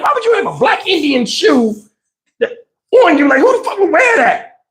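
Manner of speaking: put-on high, incredulous kid voice; a baffled child asking who would wear that; confused kid.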